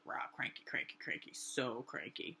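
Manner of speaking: low, soft cranky grumble; mock grumbling. Heading to the bathroom in a bad mood, mock grumbling. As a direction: grumble it under your breath. Acting out her own grumbling.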